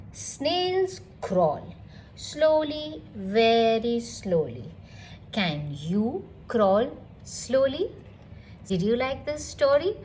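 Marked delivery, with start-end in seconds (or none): none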